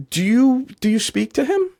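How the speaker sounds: put-on high, bright-eyed girl's question. Girl voiced in a story.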